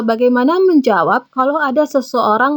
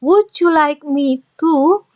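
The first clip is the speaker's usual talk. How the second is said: slow, careful teacher model of the phrase; 5 semitones higher, slower than usual. Shown as a demonstration. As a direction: say it slowly for learners to copy. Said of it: "To di sini silakan diisi". (diucapkan pelan sebagai contoh)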